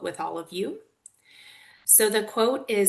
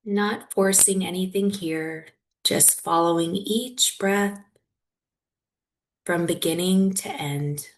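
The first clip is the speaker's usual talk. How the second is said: guided meditation. Real, not acted. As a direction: guide them gently through it, leaving long silences.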